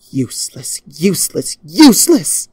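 princess rising from muttered to screamed despair; repeated word climbing into a furious cry; building to a scream. Acted.

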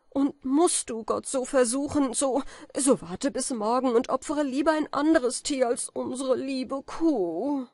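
hoch, laut, langsam beschwörend; beschwör den Freund, sich zu verschonen; verzweifeltes Flehen. A narrator's reading. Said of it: "schrie er mit der Stimme der Verzweiflung". (Kaspar fleht Falke an, verzweifelt und laut)

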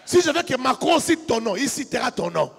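loud, high sermon climax over music; fiery preaching. Acted. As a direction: preach it full force to the crowd, half singing. Preaching at full force.

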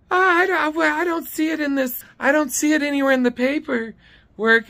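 high, stammering mock office lady; high caricature making excuses; Dee's high-pitched voice. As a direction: mimic a flustered clerk in falsetto. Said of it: "She has this high-pitched voice".

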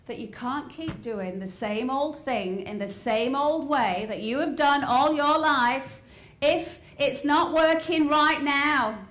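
ordinary delivery

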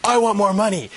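high, loud mock demand; blunt demand. Expert imitating employee asking boss, mock demanding. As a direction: blurt it out like a clumsy employee. Mimics clumsy demand for raise.

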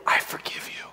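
a soft whisper of mercy; tender whisper; whispered forgiveness. Retold or imitated. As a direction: breathe it as a secret pardon.

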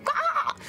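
high squeaky fake shriek; mock scream. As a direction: yelp in pretend horror. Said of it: "being so, like".

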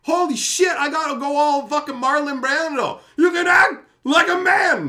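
high, loud exasperated outburst; loud mock tough-guy rant at a crying boy; exasperated yell. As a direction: yell it in disbelief at crying kids. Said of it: "And I'm like".

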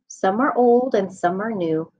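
usual speaking voice

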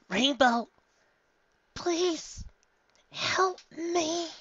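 high, thin filly voice calling for help; a small voice begging from far away; filly's distant plea. Acted. Scootaloo begs Rainbow Dash for help from afar, high and faint.

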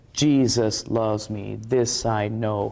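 slow, even chant accenting the first syllable of Jesus; JE-sus LOVES me, square on the downbeat; stress on the one beat. Shown as a demonstration. Showing viewers the simple song on the beat, slow march-like stress. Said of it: "The emphasis is on the one beat".

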